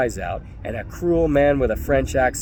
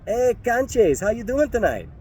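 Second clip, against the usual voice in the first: higher, taunting street tough; mocking thug greeting; 10 semitones higher than usual. Thug taunting the narrator on the street, mocking. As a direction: sneer the greeting up high. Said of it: "One of them said".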